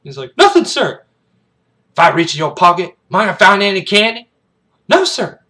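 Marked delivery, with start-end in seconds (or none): 0.4-1.0 high, loud military answer; sharp shouted response from the ranks; cadet's shouted reply. Acted. Scared cadet answers sergeant. 1.9-4.3 loud, raised-pitch drill-sergeant question; taunting military shout; interrogating bark. Acted. 4.9-5.4 high, loud denial; pitched-up military answer; cadet's shouted reply. Acted.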